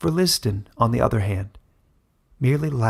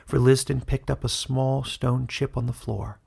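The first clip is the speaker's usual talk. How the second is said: usual speaking voice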